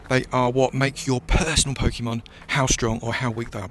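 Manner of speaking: ordinary delivery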